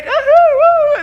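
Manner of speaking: high, loud mock woo-hoo; exaggerated carefree cheer; gleeful whoop. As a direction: whoop it up in falsetto. Mimes yellow brick road glee.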